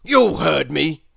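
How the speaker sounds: loud, curt snap; snapping. A narrator's reading. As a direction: bite the words off like a grouch. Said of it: "snapped Mister Grumpy".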